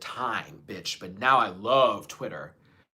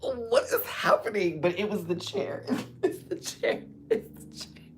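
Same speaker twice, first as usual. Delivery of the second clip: laughing; 6 semitones higher than usual. Real, not acted. Explaining the chair noise, laughing. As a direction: giggle out each phrase.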